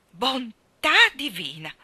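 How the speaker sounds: loud, slow exclamation of shock; astonished exclamation. Acted. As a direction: gasp it out, dumbfounded. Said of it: "esclamò il signor Harrison, esterrefatto". (esclamazione stupita, forte e lenta)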